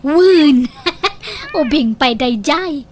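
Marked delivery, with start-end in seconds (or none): 0.8-1.5 short amused laugh; a quick teasing laugh at him. Acted. Laughing at the idea of a young bride.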